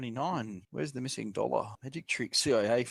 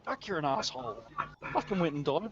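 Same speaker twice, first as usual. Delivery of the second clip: mock outrage; 8 semitones higher than usual. Mock outrage about Jesus.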